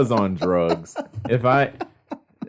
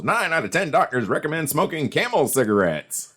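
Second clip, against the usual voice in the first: chipper 1950s ad; 7 semitones higher than usual. Retold or imitated. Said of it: "they'd have commercials".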